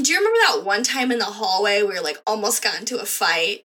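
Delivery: ordinary talk, nothing marked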